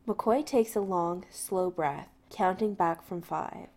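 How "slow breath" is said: plain reading voice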